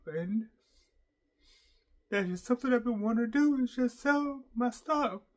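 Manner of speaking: openly crying while telling the story. Real, not acted. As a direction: cry through the words, voice cracking. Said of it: "try not to cry".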